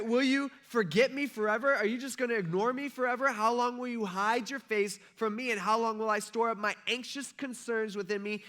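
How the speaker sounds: loud, high, impassioned questions; acted despairing lament. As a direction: hurl each question up at God. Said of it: "David felt depressed".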